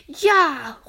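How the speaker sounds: laut und höher gerufen; kräftig und entschlossen rufen; entschlossener Ruf. A narrator's reading. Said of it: "rufen die anderen entschlossen". (Kinder rufen gemeinsam, laut entschlossen)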